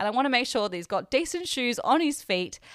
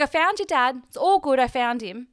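high, loud imitation of a reassuring helper; upbeat retold reassurance, pitched up; retold good news; 4 semitones higher, 6 dB louder than usual. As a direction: announce the good news, imitating a store lady. Store lady reports good news, retold.

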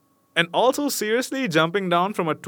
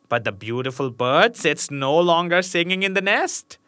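mocking witch; 3 semitones higher, 3 dB louder than usual. A narrator's reading. Witch taunts the king's son about Rapunzel, mocking sneer. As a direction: gloat and tease cruelly. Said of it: "she cried mockingly".

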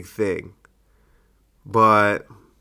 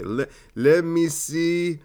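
Steve Harvey; 6 semitones higher than usual. Shown as a demonstration. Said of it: "I was gonna do a Family Feud thing".

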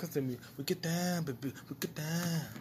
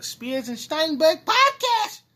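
put-on high voice; 12 semitones higher, 8 dB louder, much slower than usual.

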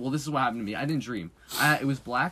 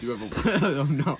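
laughing; 2 semitones higher than usual. Real, not acted. Laughing at waterbed question.

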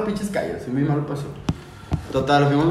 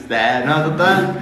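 ordinary talk

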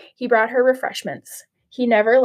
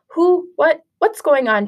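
sudden high startled questions; shocked stepmother; 6 semitones higher, 4 dB louder, slower than usual. Acted. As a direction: jump up in pitch, caught off guard.

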